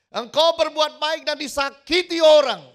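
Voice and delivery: loud, high, fervent exhortation; rising shouted sermon appeal; preaching at full force. Acted. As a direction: urge the congregation on with everything.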